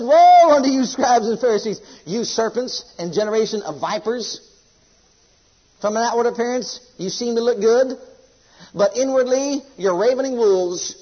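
loud, high cry on woe, then settling; thundering rebuke. Acted. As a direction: rain judgment on the Pharisees. Voices Jesus rebuking Pharisees.

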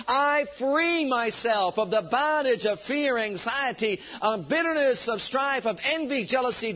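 rally the people with fire; fiery revolutionary speech. Acted.

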